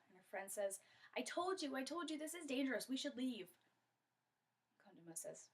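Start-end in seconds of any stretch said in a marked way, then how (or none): 1.2-3.5 higher, insistent I-told-you-so; worried warning. Acted. Friend warns Kondoma about the cobra, worried warning. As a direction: plead to get out of danger.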